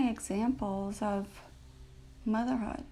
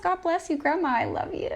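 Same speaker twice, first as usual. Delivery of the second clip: high, louder, beaming thanks; joyful blessing; 8 semitones higher, 4 dB louder than usual. Real, not acted. Blessing her late grandmother aloud, joyful and high. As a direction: call out love to her with a full heart.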